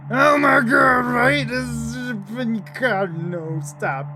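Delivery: high, loud creeped-out yelp; loud alarmed outburst; freaked-out cry. Real, not acted. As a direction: shriek in disgust and fear. Creeped out by Karen.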